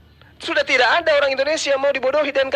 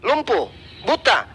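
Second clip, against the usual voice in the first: ordinary delivery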